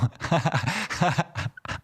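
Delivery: high helpless laugh; laughing hard at own joke; high laughter. Real, not acted. Streamer cracks up at his own rude joke, high laugh.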